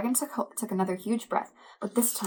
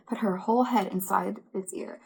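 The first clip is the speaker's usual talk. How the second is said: ordinary delivery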